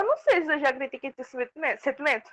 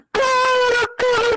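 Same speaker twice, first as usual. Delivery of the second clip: shouting; 10 semitones higher, 5 dB louder than usual. Real, not acted. S3 yelling at friends to stop.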